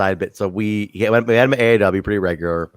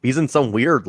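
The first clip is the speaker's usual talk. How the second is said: normal talking voice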